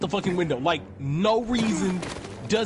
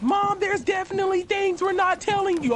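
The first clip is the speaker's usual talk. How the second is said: mock Ethan; 12 semitones higher, 3 dB louder than usual. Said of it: "Ethan coming up the stairs".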